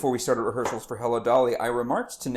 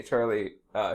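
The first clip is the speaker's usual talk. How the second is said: ordinary delivery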